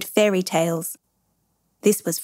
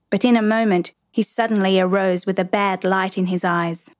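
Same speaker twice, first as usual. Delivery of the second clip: ordinary delivery, nothing marked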